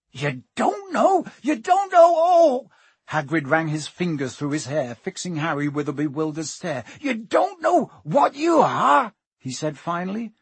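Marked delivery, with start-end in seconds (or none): 0.1-2.7 very high, slow cry of astonishment; aghast disbelief. A narrator's reading. Hagrid astounded, about to explode. 7.0-9.1 slow, loud, high amazement; bewildered disbelief. A narrator's reading. Bewildered Hagrid finally speaks.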